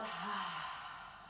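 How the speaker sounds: soft audible exhale; sigh out. Shown as a demonstration. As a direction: release the breath in a sigh. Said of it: "breathe out".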